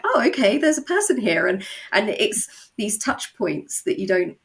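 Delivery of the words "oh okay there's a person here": high, loud mock surprise at a real fan; surprised realisation. Podcaster recalls finding a real fan, high surprised. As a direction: pop up in pitch, oh a real person. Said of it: "And I'm like".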